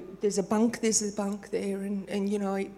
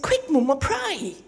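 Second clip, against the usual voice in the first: urgent plea; 5 semitones higher, 4 dB louder, slower than usual. Retold or imitated. Scared child during storm.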